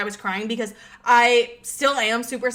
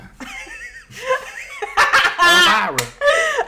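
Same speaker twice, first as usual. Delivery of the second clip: high, long cackling laugh; high cackle; 14 semitones higher than usual. Real, not acted. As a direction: break into a long shrill cackle. Laughs at the name.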